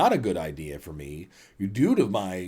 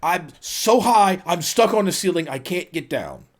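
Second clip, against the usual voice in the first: higher, louder retold alarm; mock panic; 6 semitones higher, 5 dB louder than usual. Wife panicking to husband that she's stuck, mock panic. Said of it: "she's in a panic".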